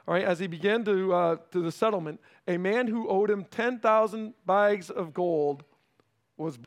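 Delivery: ordinary talk, nothing marked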